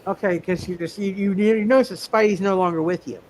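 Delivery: normal talking voice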